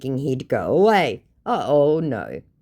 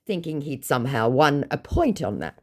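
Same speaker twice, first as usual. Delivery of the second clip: usual speaking voice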